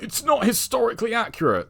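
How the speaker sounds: high-pitched mock pedant; mocking complainers. Mimicking critics of the film.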